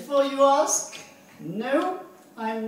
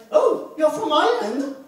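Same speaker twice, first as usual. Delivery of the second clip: higher, louder mock surprise; surprised guess; 4 semitones higher, 7 dB louder than usual. Someone guessing the comedian's origin, mock surprise. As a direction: act out a delighted sudden realisation, loudly.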